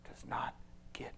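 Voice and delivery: soft hushed earnest whisper; quiet intimate sermon close; whispered promise. Real, not acted. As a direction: draw them close and whisper it.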